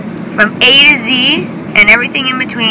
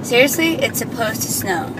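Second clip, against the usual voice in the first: ordinary delivery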